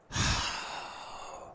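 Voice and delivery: long, breathy sigh of dismay; exasperated sigh. Real, not acted. Dismay after the mishap.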